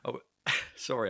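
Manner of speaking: apologising through helpless giggles; real stifled laughter. Real, not acted. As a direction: laugh breaking into a sheepish apology. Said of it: "I shouldn't laugh".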